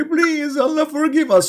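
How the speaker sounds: high pleading cry; begging sinner. Retold or imitated. Mock praying voice.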